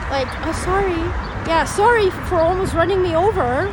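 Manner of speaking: high loud mock apology; mocking driver. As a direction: sarcastically mimic the careless driver. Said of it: "she's like".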